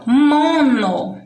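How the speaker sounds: "mono" stretched out slowly as "mooo-no"; slow, emphatic "mono"; highlighted prefix. Shown as a demonstration. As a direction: hold the first syllable of "mono". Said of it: "observe a parte colorida".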